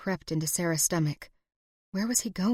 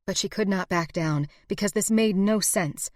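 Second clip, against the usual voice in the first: usual speaking voice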